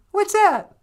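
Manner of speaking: very high, loud, chirpy voice; sweetie character. Acted. Sweetie answering a request for a favor, high put-on voice. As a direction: call back in a high squeaky voice. Acting a sweetie who operates the teleporter.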